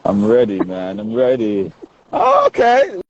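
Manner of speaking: usual speaking voice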